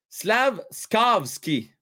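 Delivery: "Slavskovski" very slowly, syllable by syllable; slow careful spelling-out. Shown as a demonstration. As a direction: drag out "Slavskovski" to get it right. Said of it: "Je vais essayer de retenir ça".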